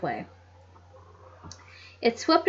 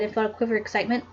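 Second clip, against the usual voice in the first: usual speaking voice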